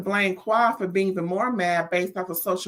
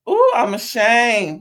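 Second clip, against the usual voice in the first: mock ashamed; 3 semitones higher, 7 dB louder, slower than usual. Real, not acted.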